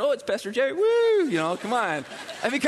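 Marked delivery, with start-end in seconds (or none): 0.0-1.3 playful fake excitement ending in a loud woo; mock delighted squeal. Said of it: "all of a sudden".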